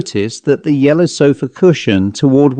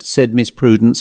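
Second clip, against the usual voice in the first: usual speaking voice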